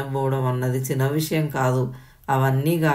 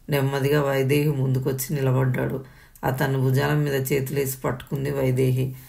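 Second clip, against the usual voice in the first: ordinary delivery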